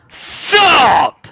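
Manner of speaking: high, loud sup; shouted greeting. Real, not acted. Greeting L P on stream with a loud high sup. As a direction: yell it out as a greeting.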